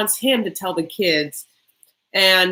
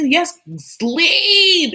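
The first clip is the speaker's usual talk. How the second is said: much higher, louder drawn-out demand; mock gleeful yell; 10 semitones higher, 8 dB louder than usual. Boys tell him to go home, mock yelling. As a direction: imitate kids yelling someone out of the house.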